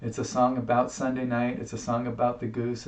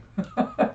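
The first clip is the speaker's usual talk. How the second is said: short chuckle at his own remark; a brief breathy laugh between phrases. Real, not acted. Admitting he never knew the first name, amused chuckle.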